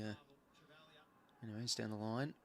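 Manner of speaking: normal talking voice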